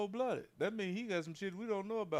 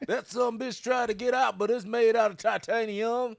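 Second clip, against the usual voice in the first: high, loud hammed-up country trapper; 7 semitones higher, 6 dB louder than usual. Bragging to his cohost about the possum cage, hammed-up trapper voice.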